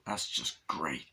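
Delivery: slow voiceless deadpan; whispered sarcasm. Retold or imitated. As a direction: mutter it bitterly under breath. Character's deadpan reaction.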